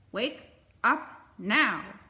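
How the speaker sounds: loud, slow moose command; moose booming. Acted. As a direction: boom it out like a big moose. Moose waking the bear.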